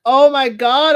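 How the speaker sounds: loud, high "Oh my God"; a burst of disbelief; shocked. Retold or imitated. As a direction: blurt it out in shock. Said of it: "I was like".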